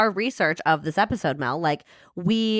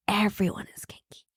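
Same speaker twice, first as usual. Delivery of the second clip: whispering; 4 dB quieter than usual. Pretend. Confiding a naughty secret.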